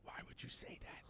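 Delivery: hushed retold whisper from the wife. Wife hissing at husband for telling the kid, whispered. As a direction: whisper it sharply under the breath. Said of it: "She goes".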